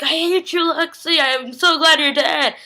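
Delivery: mock mean. Retelling a mean character taunting Lexi, mock mean. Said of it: "when he started being mean".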